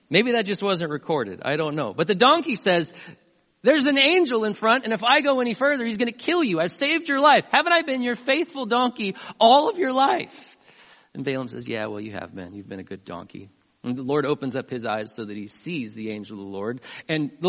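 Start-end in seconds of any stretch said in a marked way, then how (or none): 3.6-10.6 high, loud donkey pleading its case; urgent retold plea, pitched well up; indignant protest. Voices donkey protesting to its owner. 11.7-13.5 low, soft admission; understated retold owner giving in; sheepish concession. Voices owner conceding to the donkey.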